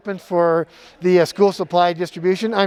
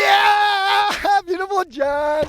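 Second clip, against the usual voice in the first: high cheering shout with a laugh; excited cheer; 12 semitones higher, much slower than usual. Acted.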